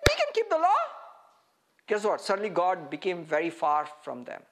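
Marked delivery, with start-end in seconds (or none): 0.1-0.8 high, loud, scoffing boast; scoffing Israel. Retold or imitated. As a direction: scoff like a proud people who think it's easy. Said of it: "He said".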